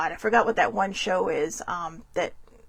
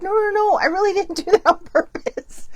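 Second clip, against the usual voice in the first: 1 high protesting mom; 10 semitones higher, 4 dB louder than usual. Retold or imitated. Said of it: "She's like".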